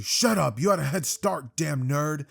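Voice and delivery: mock-angry comeback, thrown out loud; annoyed character shouting back; irritated retort. Acted retort of Bakugo.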